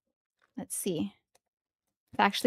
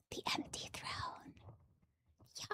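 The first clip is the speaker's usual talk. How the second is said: awed whisper; 23 dB quieter than usual. Real, not acted. Fan reading a rare card, whispering in awe. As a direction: breathe the name in reverence.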